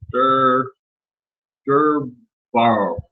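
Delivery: "Dur-", pause, then a tentative "Durbauer"; unsure pronunciation. Real, not acted. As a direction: sound out "Durbauer" haltingly, piece by piece. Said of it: "I don't know how to pronounce that".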